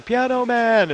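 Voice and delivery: loud, high holler from the crowd; mock concert-goer's scream; retold scream. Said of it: "and I was screaming".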